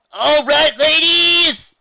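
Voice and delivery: very high, loud, stretched-out call; rousing shout. Acted. Final Scratch calling the band to order, rousing shout. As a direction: holler it across the stage.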